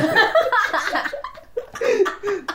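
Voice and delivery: long embarrassed laugh; big laugh. Real, not acted. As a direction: burst out laughing at himself. Laughing at his own date. (自嘲大笑)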